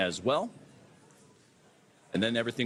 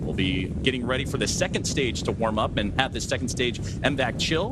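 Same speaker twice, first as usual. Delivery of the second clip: louder, urgent launch commentary; live play-by-play; 5 dB louder than usual. Acted. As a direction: call the ascent live with energy.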